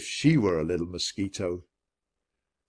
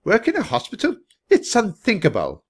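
high, raised-voice scorn; snorting disdain; 11 semitones higher, 5 dB louder than usual. A narrator's reading. Lady Bickerson rejects hospital work for Florence, snorting disdain. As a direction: scoff as if scandalized. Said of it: "said Lady Bickerson with a snort".

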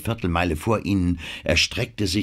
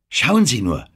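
higher, louder exclamation; calling out; 6 semitones higher, 5 dB louder than usual. A narrator's reading. Anne calls the others to look at the fog, calling out. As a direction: call out to draw their attention. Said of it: "rief Anne".

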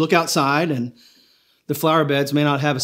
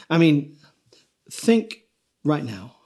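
ordinary delivery

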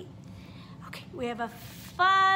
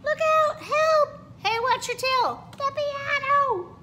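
much higher, loud yelling, last words slow; panicked shouts; 14 semitones higher, 7 dB louder, slower than usual. Acted. Dancers scream as the dinosaur smashes the studio, loud. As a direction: shout in alarm as the tail swings.

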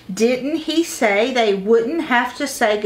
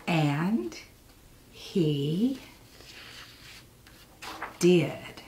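soft, quiet payoff; storyteller softly revealing the miracle; hushed reveal; 4 semitones lower, 9 dB quieter, much slower than usual. A narrator's reading. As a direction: drop to a near hush. Hushed payoff after the climax.

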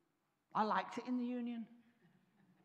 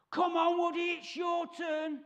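higher and louder, drawn out egging on; urging shout; 6 semitones higher, 4 dB louder, slower than usual. Retold or imitated. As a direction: call him over to join in, slowly. Friends urging him to join.

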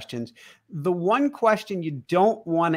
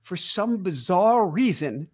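ordinary talk, nothing marked

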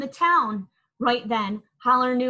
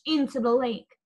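ordinary delivery

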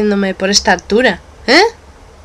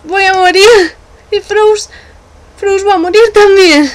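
panicked fear; 8 semitones higher, 3 dB louder than usual. Acted.

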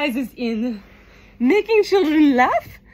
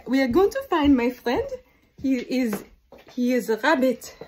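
normal talking voice

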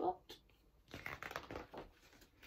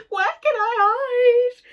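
high, very loud panicked wail; frightened cry; 12 semitones higher, 14 dB louder than usual. A narrator's reading. Boy hunts for a hiding place, crying out in panic. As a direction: cry out desperate for cover. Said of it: "cried Eddie".

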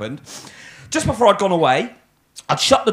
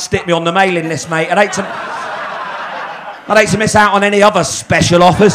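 mock-grateful, laughing and louder; sarcastic, laughing through it; 4 dB louder than usual. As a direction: pile on the fake thanks. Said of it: "Don't be sarcastic".

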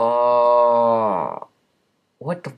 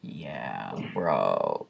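lowered mock-deep voice; 13 semitones lower, 8 dB quieter than usual. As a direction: drop your voice low for a joke. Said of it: "like, a really deep voice".

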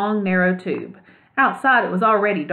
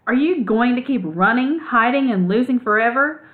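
urgent higher push; pressing; 6 semitones higher, 3 dB louder than usual. A narrator's reading. Ragweed pressing Clutch to stop running and be free, urgent. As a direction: lean on her with each question. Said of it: "Ragweed pressed".

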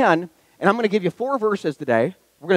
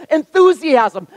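usual speaking voice

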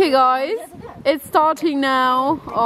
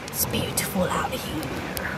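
soft whisper, in awe; 5 semitones lower, 9 dB quieter than usual. Real, not acted. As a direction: whisper it, taking in the view. Quiet awe at the view.

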